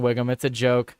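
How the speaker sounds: ordinary talk, nothing marked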